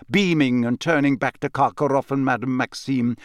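ordinary delivery

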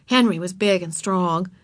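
ordinary delivery, nothing marked

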